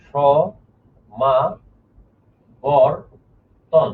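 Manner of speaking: স, মা, বর, তন split into four slow beats with pauses; syllable by syllable. Shown as a demonstration. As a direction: break সমাবর্তন into স, মা, বর, তন, one beat each. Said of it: "ধীরে ধীরে ভেঙে ভেঙে উচ্চারণ করব".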